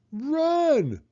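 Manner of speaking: very high, loud urgent cry; monkey shout. A narrator's reading. Monkey warns the children, urgent shout. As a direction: yell to make them flee. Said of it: "Mugglewump shouted".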